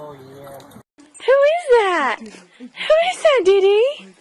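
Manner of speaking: slow, drawn-out teasing excitement; excited pet talk. Real, not acted. Cues dog to returning person.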